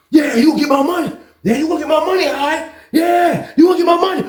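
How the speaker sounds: high, hammered-out yelling; retold angry yell. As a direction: bark each threat while landing blows.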